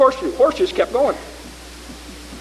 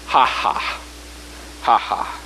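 low scoffing ha-ha; 8 semitones lower, 3 dB quieter, slower than usual. Shown as a demonstration. Scoffing at the valley, unvoiced.

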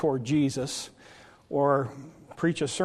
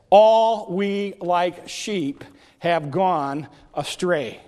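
very slow, phrase-by-phrase verse; solemn scripture quote; much slower than usual. Real, not acted. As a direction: proclaim the verse with long pauses. Announcing sermon title from verse.

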